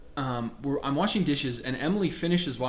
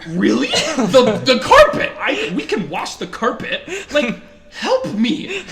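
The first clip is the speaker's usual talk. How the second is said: high, loud, laughing disbelief; high, loud, laughing complaint about the carpet; laughing outraged plea; 8 semitones higher, 11 dB louder, slower than usual. Retold or imitated. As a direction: plead in mock outrage through laughter. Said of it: "I'm like".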